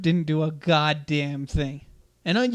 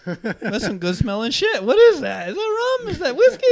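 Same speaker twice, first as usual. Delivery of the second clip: mock sniffing student; 9 semitones higher, 8 dB louder than usual. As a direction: sniff the air and wonder aloud. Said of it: "you're like".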